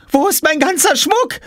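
put-on very high girlish screech; shrieking. A narrator's reading. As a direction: screech it as an outraged girl. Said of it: "Jule kreischte". (kreischend, sehr hohe Mädchenstimme)

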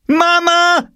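piercingly high, loud yell for Mum; screaming. A narrator's reading. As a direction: scream for your mother. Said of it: "schrie Jule".

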